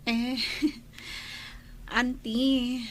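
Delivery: slow, bashful giggle into the word; embarrassed niece giggling at her aunt's question; shy laugh. Acted. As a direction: laugh it off shyly to her aunt. Shy niece teased about suitor.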